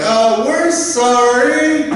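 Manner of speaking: high, sing-song, drawn-out sorry; mock apology. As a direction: stretch it into a syrupy fake apology. Mocking the apologizing airline staff.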